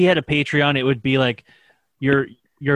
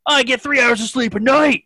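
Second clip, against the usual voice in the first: mock boast; 7 semitones higher, 3 dB louder than usual. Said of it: "he'd be like".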